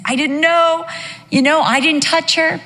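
high, pleading protest; protesting king. Acted. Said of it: "declares his righteousness".

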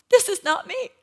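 high, loud pleading protest; desperate denial. Retold or imitated. Preacher retelling her plea to God, high loud denial. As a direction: insist it isn't you, loudly. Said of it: "I'm telling God".

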